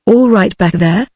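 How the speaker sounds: ordinary delivery, nothing marked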